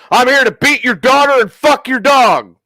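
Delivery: high, loud mock tough retort; deadpan comeback. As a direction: fire it back without missing a beat. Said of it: "without missing a beat, looks him dead in the eye and goes".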